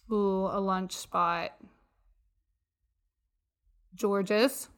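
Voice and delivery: ordinary talk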